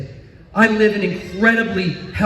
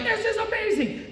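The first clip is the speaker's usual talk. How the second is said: wife's delight; 14 semitones higher, 9 dB quieter than usual. Retold or imitated. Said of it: "She's like".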